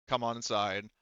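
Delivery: usual speaking voice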